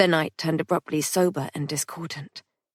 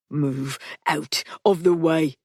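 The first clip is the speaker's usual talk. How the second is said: cold threat; 2 semitones lower, slower than usual. Acted. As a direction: order him aside coldly. Patrick threatening.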